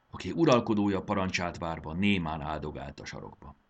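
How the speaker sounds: ordinary delivery, nothing marked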